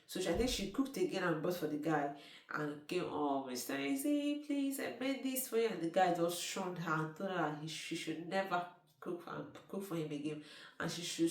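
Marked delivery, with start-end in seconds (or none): 3.1-5.7 high and honeyed, offering him a dish; sweet pleading girl. Retold or imitated. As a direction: sweet-talk him as if bringing a gift. Girl sweetly offers food to man.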